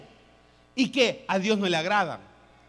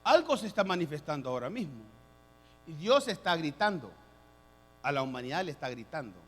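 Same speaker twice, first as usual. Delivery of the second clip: ordinary talk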